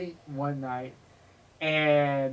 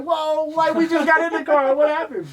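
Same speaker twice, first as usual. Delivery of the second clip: high, louder startled confusion; shocked; 10 semitones higher, 4 dB louder than usual. Retold or imitated. Riders just kicked out of the car, high startled confusion. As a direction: sound caught off guard and baffled.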